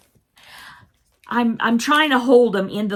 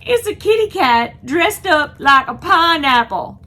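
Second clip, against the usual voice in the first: delighted, higher and louder exclaim; excited, higher and louder; 6 semitones higher, 6 dB louder than usual. Real, not acted. To viewers about the pineapple cat costume, thrilled. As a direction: announce it with thrilled disbelief.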